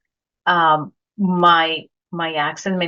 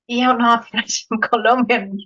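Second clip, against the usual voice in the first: amused retold reply, higher, breaking into a laugh; laughing comeback; 5 semitones higher than usual. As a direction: retell the comeback through a laugh. Retelling her funny comeback, laughs.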